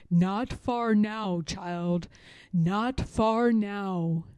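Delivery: a kindly, reassuring murmur; comforting. Acted. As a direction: soothe the exhausted runaway. Couple comforts Harriet.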